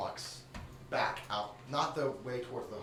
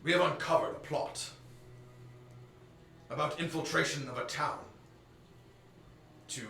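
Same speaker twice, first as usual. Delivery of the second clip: formal admiral; 4 semitones higher, 6 dB louder than usual. Acted. Admiral argues in court.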